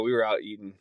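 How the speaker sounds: usual speaking voice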